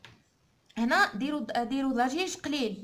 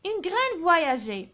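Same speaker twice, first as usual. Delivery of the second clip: very slow, projected reading; a teacher's slow, louder model line; poem read aloud; 2 semitones higher, 5 dB louder, much slower than usual. Shown as a demonstration. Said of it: "نقراو الشعر هذا مع بعضنا".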